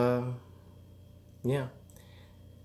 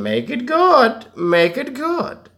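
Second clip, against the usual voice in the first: much higher, slow and drawn out; high slow drawl; 13 semitones higher, 4 dB louder, slower than usual. Pretend. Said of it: "repeat contrast".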